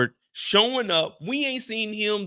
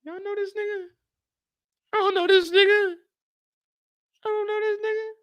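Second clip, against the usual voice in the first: high mock bystander; 12 semitones higher than usual.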